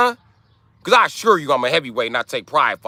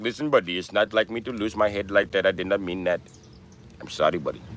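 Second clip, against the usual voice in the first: mock Indian accent, lower and softer; quiet, contrite Indian-accent apology; apologetic Indian imitation; 3 semitones lower, 6 dB quieter than usual. Indian man apologizing after getting angry, imitated Indian accent. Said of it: "they will often apologize".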